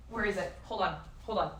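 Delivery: normal talking voice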